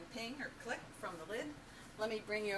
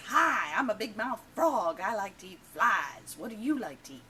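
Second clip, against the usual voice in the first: big brash joke-character voice; obnoxious big-mouth frog; 5 semitones higher, 12 dB louder than usual. Acted. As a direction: play an obnoxious frog, mouth wide open, booming out each phrase. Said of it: "and he's obnoxious".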